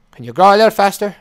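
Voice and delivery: high, loud impatient plea; loud, exasperated request; impatient urging. Pretend. Impatiently urging plant to grow.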